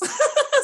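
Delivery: short high burst of laughter; bright high laugh. Real, not acted. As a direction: laugh out loud at the joke. Laughing at nameless iPhone viewer.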